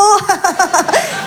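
high-pitched acted cackle; forced joking laugh. Woman jokes to handsome neighbour, laughing too hard. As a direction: laugh along at your own joke. Laughs at her own joke.

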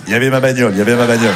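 lightly voiced dumbfounded beauf. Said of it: "Tu parles comme un beauf". (léger accent de beauf hébété)

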